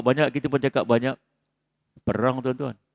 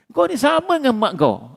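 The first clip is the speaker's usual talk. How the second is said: mock insult; 10 semitones higher than usual. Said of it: "Jangan kaitkan dengan mak dia".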